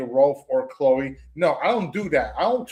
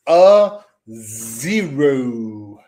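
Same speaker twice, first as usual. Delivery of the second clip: loud, very slow, drawn-out name-calling; mocking announcement; 5 semitones lower, 7 dB louder, much slower than usual. Real, not acted. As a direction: stretch the nickname out long and loud. Announces mocking nickname with drawn-out flourish.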